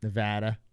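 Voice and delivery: Nevada said with the middle a as in "cat"; trying the other pronunciation. Shown as a demonstration. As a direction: try "Nevada" as nə-VAT-ə, flat a like cat. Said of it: "Is that how you say it?".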